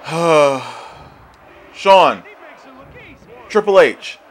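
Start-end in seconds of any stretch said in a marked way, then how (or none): 1.7-4.2 loud, raised, falling calls; exasperated call. Real, not acted. Gamer calling his wrestlers, exasperated shout. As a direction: call each name as a rebuke.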